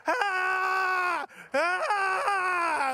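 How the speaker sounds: long, high mock scream; imitating panicked son. Son running round the backyard, long high mock scream. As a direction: hold a high childlike wail of panic. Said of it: "running around the backyard. He's like".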